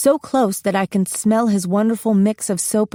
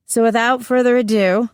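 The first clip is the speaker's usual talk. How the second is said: mildly raised, higher announcing voice; loud announcement; 4 semitones higher than usual. A narrator's reading. Angela introducing the queen to the class, loud announcement. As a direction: announce it to the whole room, moderately loud. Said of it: "says Angela at last loudly".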